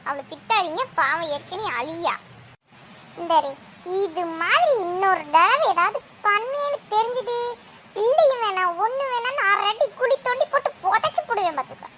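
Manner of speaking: usual speaking voice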